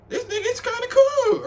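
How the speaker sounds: very high, squeaky repeat; squeaky excited. Retold or imitated. Thinking the guy is cool, in a very high squeak. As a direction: squeak it out with delight. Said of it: "I'm like".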